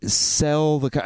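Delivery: very slow, halting translator voice. Retold or imitated. Man translating for the clerk to a customer, halting and slow. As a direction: feel out each phrase slowly, with long gaps. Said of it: "kinda translates for me".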